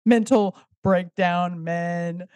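very slow, spelling out the pun; slow deliberate pun delivery; slow punchline. Acted. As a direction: drag each word out so the joke lands. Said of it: "Get it?".

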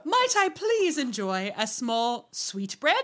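put-on slow, overly proper little boy; mock posh child. Podcaster mocking grown-up Jemmy's manners. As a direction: draw out each prim word. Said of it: "He's very, uh, adult".

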